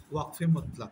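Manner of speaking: ordinary delivery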